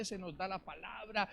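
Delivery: normal talking voice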